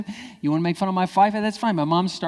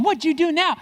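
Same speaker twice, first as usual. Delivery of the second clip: high, loud accusing mom; mock maternal outrage; exasperated mom; 13 semitones higher, 5 dB louder than usual. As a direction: imitate a mom bursting in.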